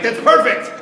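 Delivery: loud, high mock approval; hammed-up exclamation of delight; delighted director. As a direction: imitate a director bursting with praise. Director delighted by the underplayed line.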